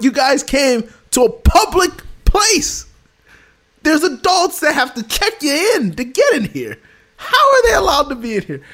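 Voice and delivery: high, incredulous lecturing tone; exasperated retold rant. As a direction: scold in disbelief, voice pushed up. Retold rant at kids' sister.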